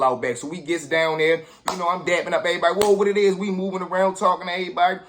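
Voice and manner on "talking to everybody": ordinary delivery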